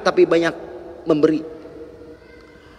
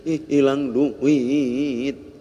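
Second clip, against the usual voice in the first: sad servant; 5 semitones lower, much slower than usual. Acted. Crying servant tells Rasulullah.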